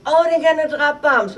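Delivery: high, loud panicked cry; voicing the terrified soldier, high and loud; frightened soldier. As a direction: blurt it out like a scared young soldier. Soldier panicking as searchlights appear.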